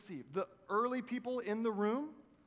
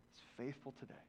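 ordinary talk

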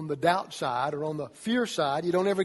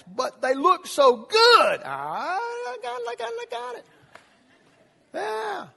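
much higher, drawn-out pining cry; lovestruck teen; 6 dB louder than usual. Pretend. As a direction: swoon over a crush. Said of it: "he may say".

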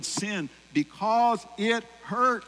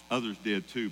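ordinary talk

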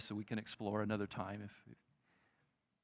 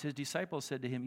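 ordinary delivery

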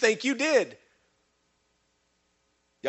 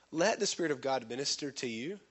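ordinary talk, nothing marked

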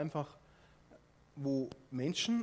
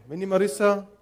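ordinary talk, nothing marked

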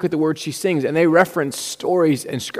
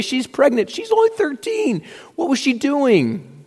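high, scandalized exclaiming; shocked gossip; 9 semitones higher than usual. Retold or imitated.

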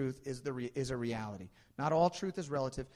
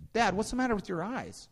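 put-on curious little boy; 9 semitones higher, 6 dB louder than usual. Son voiced in a story.

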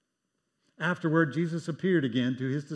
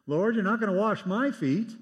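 higher, louder indignant objection; protesting tone; 8 semitones higher, 7 dB louder than usual. Retold or imitated. Peter protesting as Jesus moves to wash his feet, indignant. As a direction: refuse to be served. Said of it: "Peter, in a protesting tone".

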